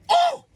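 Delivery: high, loud exasperated "oh"; sharp outburst of exasperation; frustrated cry. Acted. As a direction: yelp it out in fury. Speechless with anger.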